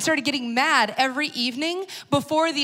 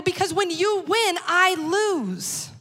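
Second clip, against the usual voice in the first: frustrated blurt; 6 semitones higher, slower than usual. Retold or imitated. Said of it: "And I go".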